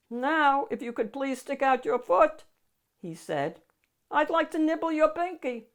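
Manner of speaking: polite hungry monster. Acted. Gabe politely asks the child for a toe. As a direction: ask sweetly like a hungry beast.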